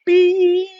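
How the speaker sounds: a sudden, very high, loud, steady beeee; imitated tinnitus whine. Shown as a demonstration. Vlogger imitating his tinnitus for viewers, very high loud whine. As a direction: mimic the ringing in the ears with one piercing high note. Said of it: "I have a constant thing called tinnitus".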